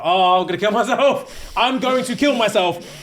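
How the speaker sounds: high, laughing wail of disbelief; laughing despair. Retold or imitated. Boyfriend hearing the betrayal, laughing in despair. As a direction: laugh through the shock. He hears she slept with him.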